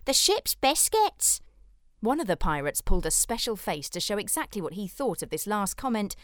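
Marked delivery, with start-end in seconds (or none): 0.1-1.4 much higher, slow pirate. A narrator's reading. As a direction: pipe it up like a squeaky pirate. Pirates shout favourites.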